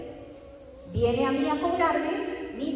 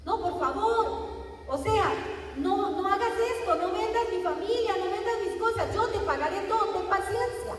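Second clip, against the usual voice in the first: higher, begging voice; pleading servant; 6 semitones higher than usual. Retold or imitated. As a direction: plead for mercy from the master.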